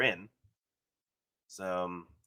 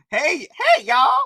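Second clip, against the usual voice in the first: high greeting voice; 8 dB louder, slower than usual. Pretend. Imitating the character greeting.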